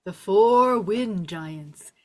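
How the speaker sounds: slow, drawn-out naming; grave announcement. Acted. Falkor telling Atreyu what the clouds are, slow and grave. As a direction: stretch it out with weight. Falkor names the looming danger.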